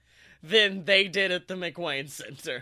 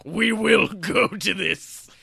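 commanding voice; 3 semitones higher, 4 dB louder, slower than usual. Retold or imitated. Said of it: "She's like".

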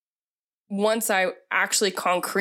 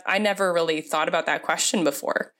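ordinary talk

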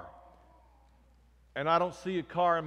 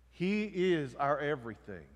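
ordinary talk

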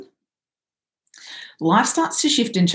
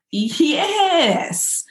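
cheerful yes; 8 semitones higher than usual. Retold or imitated. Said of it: "I'm like".

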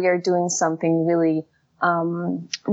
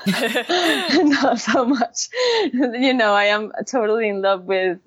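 laughing; 5 semitones higher than usual. Real, not acted. Laughs at own animal preference.

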